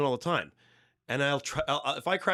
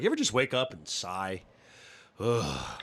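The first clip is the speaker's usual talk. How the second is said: low, drawn-out morning ugh; demo groan; 7 semitones lower, slower than usual. Shown as a demonstration. Stand-up bit on waking, low groaning sigh. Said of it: "trying to do a bit about this".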